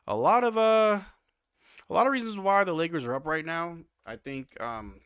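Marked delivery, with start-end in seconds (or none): 0.1-3.8 louder, higher fired-up rant; raised voice, worked up; excited. Real, not acted. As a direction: get hyped about the lead.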